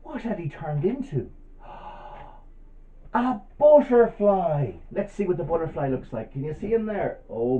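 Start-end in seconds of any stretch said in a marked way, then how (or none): 1.5-2.4 long breathy mock gasp; amazed gasp. Storyteller asking what he had turned into, astonished gasp. 3.1-4.7 high, loud, drawn-out wonder; amazed reveal. Pretend. Storyteller revealing what he turned into, thrilled wonder.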